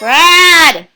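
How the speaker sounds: loud sharp call of a name; yelling across the room. Real, not acted. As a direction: yell the name to the next room. Mom calling the child.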